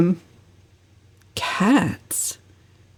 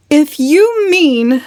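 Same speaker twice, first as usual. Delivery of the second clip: high, slow and louder, full of feeling; passionate; 9 semitones higher, 5 dB louder, much slower than usual. A narrator's reading. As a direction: plant the opening slowly with passion. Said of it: "said Valancy passionately".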